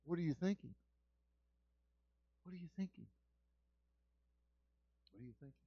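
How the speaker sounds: from soft down to almost nothing; fading repeat. Real, not acted. Repeated question fading away.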